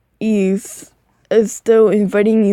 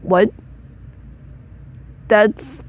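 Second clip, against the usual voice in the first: ordinary delivery, nothing marked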